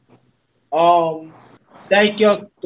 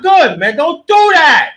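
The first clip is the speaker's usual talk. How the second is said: higher, louder warning; sharp scold; 6 semitones higher, 5 dB louder than usual. Real, not acted. Host warns a guest on the panel, loud and sharp. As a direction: call it out sharply.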